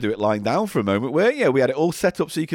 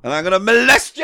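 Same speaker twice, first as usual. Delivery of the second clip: mock shout; 6 semitones higher, 6 dB louder than usual.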